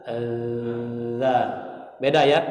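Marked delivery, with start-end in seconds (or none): 0.1-1.4 "Allah" sung long, low and light; Allah with zal. Shown as a demonstration. Teacher showing the light zal-style Allah. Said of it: "Huruf zal".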